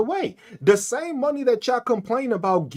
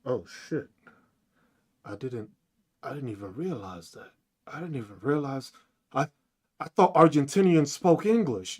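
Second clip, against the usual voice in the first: softer, lower mock voice of a clueless fan; imitating a dumb MAGA fan; 7 semitones lower, 5 dB quieter than usual. Mocking MAGA fans who left the stream, a soft clueless voice. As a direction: play the clueless supporter, quietly dumbfounded. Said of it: "half of them fucking left 'cause they didn't even".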